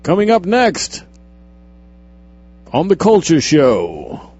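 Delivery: play-acted broadcast plug, projected; mock announcer. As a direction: announce the next segment like a TV host. Mock show announcer plugging a segment.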